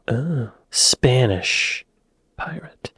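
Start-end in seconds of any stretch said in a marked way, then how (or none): none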